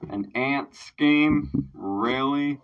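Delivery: slow, loud, stunned question; stunned disbelief. Real, not acted. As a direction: let the disbelief hit you slowly. Stunned by the wordplay.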